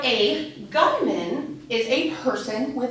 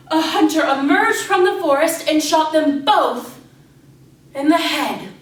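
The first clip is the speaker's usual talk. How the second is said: loud, raised storyteller's shock ending; sudden forceful story climax; dramatic climax; 5 semitones higher, 7 dB louder than usual. A narrator's reading. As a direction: hit the grim twist hard and high. Sudden violent climax of fable.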